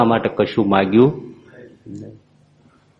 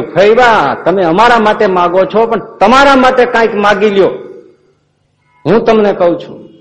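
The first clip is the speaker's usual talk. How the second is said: higher, louder, insistent Maharaj; Maharaj urging; 8 semitones higher, 5 dB louder than usual. Acted. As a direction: press her warmly to ask for herself. Maharaj insists fourth time.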